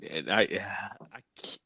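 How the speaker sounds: slow, breathless whisper of disbelief. Real, not acted. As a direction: barely say it, slowly, under your breath. Reacting to the grim story.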